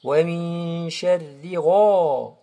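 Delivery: long hummed "min", full rounded "gha"; slow recitation swelling onto "gha"; emphatic ghain. Shown as a demonstration. Teacher models the verse, heavy "gha". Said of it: "elle est emphatique".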